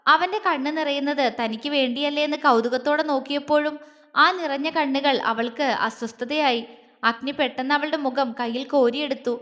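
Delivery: plain reading voice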